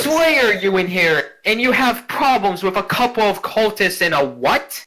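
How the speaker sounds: shrill disbelieving scold; scolding noble lady. Acted. Ghost grandmother scolding the slayer, high and indignant.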